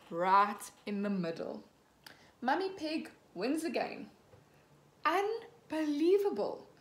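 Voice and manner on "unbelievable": slow, louder, higher disbelief; drawn-out unbelieving cry; stunned. A narrator's reading. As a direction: gasp it out, amazed. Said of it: "cries Mister Labrador".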